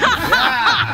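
a quick burst of high laughter; cracking up, pitched high. Real, not acted. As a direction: laugh at your own joke. Laughs after poem line.

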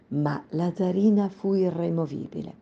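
ordinary delivery

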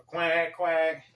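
high, loud mock quacking like a duck; playing the duck to be laughed at; humiliated duck act. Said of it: "You go".

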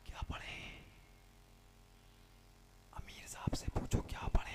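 very soft, voiceless whisper; timid whisper. Acted. Cowed newcomer asks his companion, whispering timidly. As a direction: ask secretly, afraid to be heard.

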